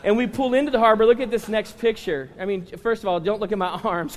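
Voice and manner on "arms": normal talking voice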